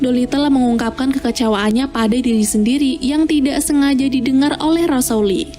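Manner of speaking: ordinary delivery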